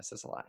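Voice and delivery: "Sicily" said as "sissa-lie", softly; mock-authentic pronunciation. Shown as a demonstration. Host conceding to the caller, mock-authentic pronunciation. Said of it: "To be authentic, it is".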